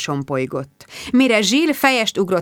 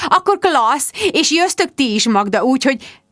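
gushing hostess; 7 semitones higher, 4 dB louder than usual. A narrator's reading. Rebecca's gushing voice.

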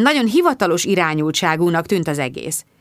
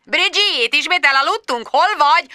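snorting boss; 10 semitones higher than usual. Acted. Richard Finch snorting at late Bridget, high and sneering. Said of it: "horkantott Richard Finch".

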